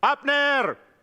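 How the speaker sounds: calling out to Abner, pitched high; retold shout. From a hill calling the enemy's man Abner, high-pitched calling out. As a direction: imitate a shout from a hilltop. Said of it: "lalu teriak".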